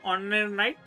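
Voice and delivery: normal talking voice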